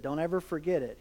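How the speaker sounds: low, softened, as a private word; confiding advice. Retold or imitated. Mentor pulling him aside with advice to remember, hushed and confiding. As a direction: pass it on quietly, like a confidence.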